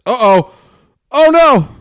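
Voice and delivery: sudden alarm as the foam spills over; real surprised shout; surprised yelp. Real, not acted. Beer foams over.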